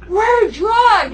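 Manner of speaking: high, loud call-out; mock party shout; drunken holler. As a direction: yell it like a drunk reveller. Retelling guys calling out drunk.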